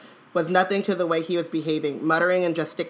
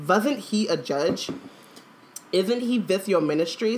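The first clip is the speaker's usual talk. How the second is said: slower voiced foreign student in an accent; Eastern European accent; slower than usual. A narrator's reading. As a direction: give Krum's lines a foreign accent. Voices Krum in story dialogue.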